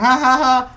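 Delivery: loud, high mock laugh; imitated giggle. Player shooting two-headed deer for fun, imitated giggling. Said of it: "just kinda giggling".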